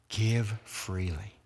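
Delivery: "Give freely" said slowly, leaning on "Give"; slow, emphatic repeat. Shown as a demonstration. Preacher repeats the charge to the congregation, slow and weighty. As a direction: stretch out "Give freely" so each word lands.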